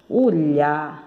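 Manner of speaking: ordinary talk, nothing marked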